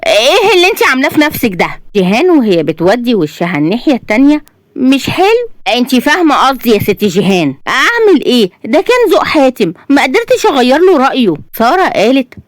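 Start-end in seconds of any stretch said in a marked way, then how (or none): 0.1-1.7 fast, high-pitched outrage; angry. A narrator's reading. Ghada confronts the bride about her look, angrily. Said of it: "غادة بغضب". 4.8-11.3 put-on high, protesting young bride; defensive bride. A narrator's reading. Bride blames groom's taste to her friend, defensive and high.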